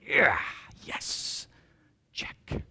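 whispered self-congratulation; quiet victory whisper to self; whispered inner gloat. Retold or imitated. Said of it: "When inside I'm going".

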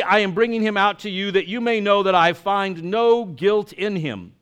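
dramatic Gospel reading from the pulpit; proclaiming the Gospel to the congregation; scripture reading. Acted. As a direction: read the Passion with feeling. Reads the Passion gospel aloud.

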